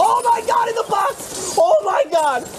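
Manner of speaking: high-pitched shriek of amazement; stunned caster screaming at a wild play; excited shout. Real, not acted. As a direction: shout it in total disbelief at the play. Caster reacts to surprise play.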